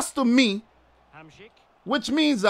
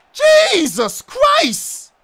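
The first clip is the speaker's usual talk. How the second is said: high, very slow drawn-out Jesus Christ; long despairing cry; exasperated wail; 11 semitones higher, 3 dB louder, much slower than usual. Real, not acted. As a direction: wail it out in disbelief. Exasperated after a missed call.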